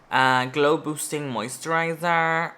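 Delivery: ordinary delivery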